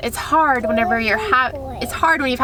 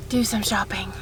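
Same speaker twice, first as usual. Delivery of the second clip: whispered tease; 3 semitones lower, 6 dB quieter than usual. Real, not acted. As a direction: whisper the last words. Whispered joke about shopping.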